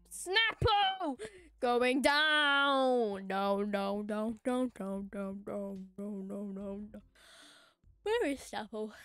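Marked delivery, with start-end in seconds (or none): 0.1-1.2 high, loud battle call. Real, not acted. Calls out the target's name. 1.6-7.0 long loud shout sinking into repeated muttering; battle cry fading. Real, not acted. Battle cry turns to dismay.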